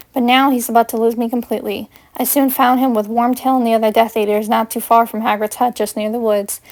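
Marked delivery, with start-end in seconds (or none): none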